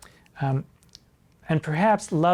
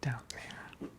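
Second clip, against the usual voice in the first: conspiratorial whisper, breathy and hushed; hushed innuendo whisper; 4 semitones lower, 18 dB quieter than usual. Pretend. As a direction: lean in and whisper it like a naughty secret. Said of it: "while we're down there".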